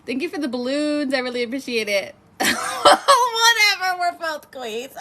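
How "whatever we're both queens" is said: high, loud laughing cry; laughing shriek. Real, not acted. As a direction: laugh it out in joyful disbelief. Laughing over shared birthday.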